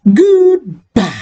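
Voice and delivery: high, loud sing-song "Goodbye"; cheery goodbye. Real, not acted. Signing off to viewers, high cheery goodbye. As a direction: sign off with a big bright goodbye. Video sign-off.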